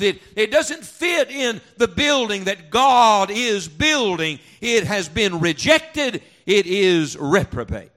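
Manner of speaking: loud, driving pulpit preaching; fiery preaching. Acted. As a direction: hammer each line home to the congregation. Preaching at full force.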